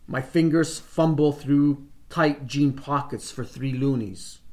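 ordinary delivery, nothing marked

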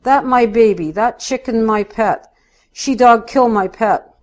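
put-on tearful protest in broken English; quavering, near tears. A narrator's reading. Missus Garofalo claiming the chicken was her pet, quavering. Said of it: "Missus Garofalo's voice quavers, like she's going to cry".

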